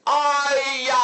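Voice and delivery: high, long-held cry of shock; great cry. A narrator's reading. Father cries out and faints, stricken. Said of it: "江环楚大叫一声".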